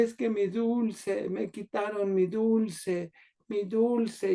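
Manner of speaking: quejido infantil agudo, lento y más suave; niña llorosa. Retold or imitated. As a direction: imitar a una niña lloriqueando, alargando las palabras. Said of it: "y lloraba la niña". (niña que lloriquea, voz aguda y lastimera)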